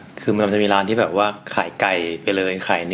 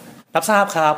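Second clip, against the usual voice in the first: higher, eager waiter reply; lightly voiced waiter, pitched higher; waiter acknowledging order; 8 semitones higher, slower than usual. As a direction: confirm the order like keen restaurant staff. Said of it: "ก็จะแบบว่า".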